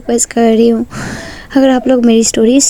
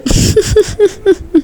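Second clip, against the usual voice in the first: high laugh; 8 semitones higher than usual. A narrator's reading. Ishika laughing at Neha.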